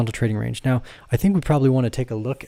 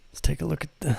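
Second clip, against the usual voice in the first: whisper; 4 semitones higher, 7 dB quieter, faster than usual. Real, not acted.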